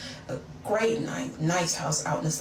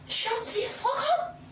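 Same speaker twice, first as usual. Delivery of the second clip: put-on high, slow squeal in a French accent; hammed-up French-accented outrage; fake French accent. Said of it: "I had that accent down to".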